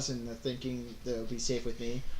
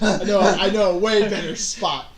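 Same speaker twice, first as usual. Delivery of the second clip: loud, gleeful in-character boast; excited scheming; 5 semitones higher, 11 dB louder than usual. Acted. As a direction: blurt out your secret plan, laughing between phrases. Acts out character's gleeful secret idea.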